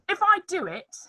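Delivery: usual speaking voice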